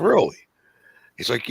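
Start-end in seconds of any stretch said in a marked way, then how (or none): 0.1-0.4 high sharp disbelief, louder; incredulous really. Retold or imitated. Retelling a trainee's whiskey coffee, stunned. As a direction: shoot it up in disbelief.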